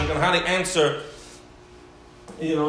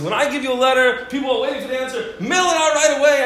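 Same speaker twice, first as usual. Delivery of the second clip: higher, louder fatherly scolding; stern rebuke; 7 semitones higher, 5 dB louder, faster than usual. Retold or imitated. Father scolds his son for holding the letter. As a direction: scold him to mail it now.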